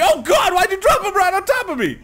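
high, loud panicked cry; alarmed yelp. Real, not acted. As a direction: yelp in shock as it lands on you. Beaver dropped on him.

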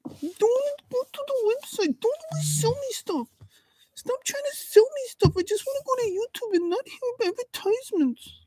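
very high, soft whiny troll complaining. Acted. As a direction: squeak out a sulky troll whine. Troll voice complains about ads.

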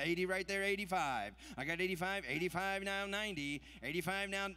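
rapid rhythmic bid chant; auctioneer. Acted. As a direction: rattle off the numbers in a rolling chant. Live charity auction bid calling.

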